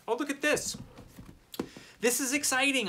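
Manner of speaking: high, bright burst of delight; squealing with excitement; excited. Real, not acted. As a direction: gush over the find. Unboxing a favourite book.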